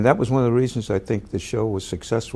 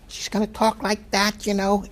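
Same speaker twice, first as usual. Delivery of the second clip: imitating her screaming; 13 semitones higher than usual. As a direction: squeal it like the screaming co-star. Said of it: "a lady who screams a lot".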